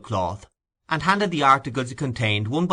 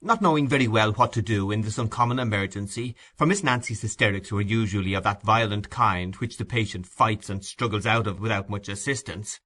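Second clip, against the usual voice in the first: ordinary delivery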